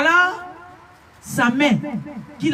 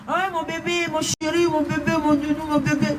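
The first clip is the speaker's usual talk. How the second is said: higher, softer mock lovey-dovey voice; mock cooing; 6 semitones higher, 3 dB quieter than usual. Preacher mimicking couples calling each other baby, mock cooing. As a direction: imitate gushing pet names in a high coo.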